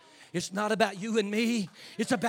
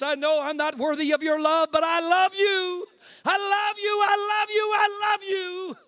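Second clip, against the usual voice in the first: crying prayer; 10 semitones higher, 6 dB louder than usual. Real, not acted. Preacher praying to Jesus, loud and tearful. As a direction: let the voice break with love. Praying aloud, breaking into tears.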